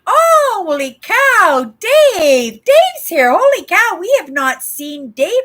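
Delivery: high, loud burst of happy astonishment; delighted surprise. Real, not acted. Long-absent viewer Dave turns up.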